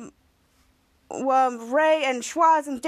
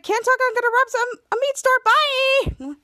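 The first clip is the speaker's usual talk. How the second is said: put-on higher, louder girl voice, slowing at the end; high hurried character voice; 9 semitones higher, 4 dB louder than usual. Retelling Mika leaving to rob a store, high put-on voice. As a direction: sing out the goodbye like a scheming kid.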